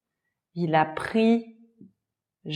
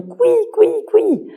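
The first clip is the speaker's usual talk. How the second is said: much higher squeaking like a mouse; 5 dB louder than usual. Shown as a demonstration. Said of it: "Les souris font". (couinement de souris très aigu)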